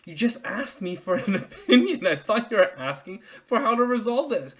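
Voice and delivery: half-laughing disbelief, pushing back; exasperated protest. Retold or imitated. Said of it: "But I'm like".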